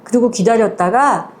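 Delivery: ordinary delivery, nothing marked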